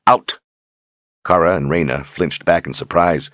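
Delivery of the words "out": sharp loud command; shouted order. A narrator's reading. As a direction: bark it out. Richard orders guards out.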